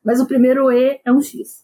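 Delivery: ordinary delivery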